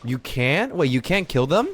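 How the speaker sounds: ordinary talk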